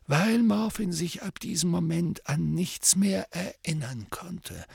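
a hint of a wise old man; lightly voiced calm old mentor; old wizard voice. Dumbledore's voice.

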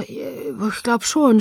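verlegen gestammelt, sehr gedehnt; stammelnd. A narrator's reading. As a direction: stammer it out haltingly, drawn out. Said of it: "stammelte ich". (stockend und verlegen stammeln)

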